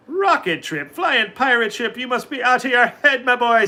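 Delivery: high, loud scoffing leprechaun; scoffing disbelief. A narrator's reading. Patrick scoffs at Mickey's rides, high loud disbelief. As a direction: laugh off a crazy idea. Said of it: "said Patrick Begorra".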